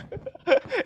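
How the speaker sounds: high burst of laughter; amused laugh. Real, not acted. Lecturer rediscovering his own slide joke, high amused laugh. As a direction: crack up at your own joke.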